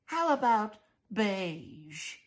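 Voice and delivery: fussy mother voice. Acted. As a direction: fret over the risk, primly. Mrs Dollard voiced in story.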